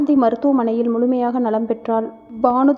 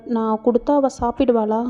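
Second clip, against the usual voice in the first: usual speaking voice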